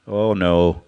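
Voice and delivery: ordinary delivery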